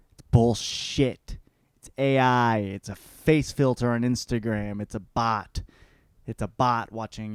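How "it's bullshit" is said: ordinary talk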